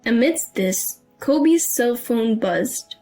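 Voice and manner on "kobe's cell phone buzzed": ordinary delivery, nothing marked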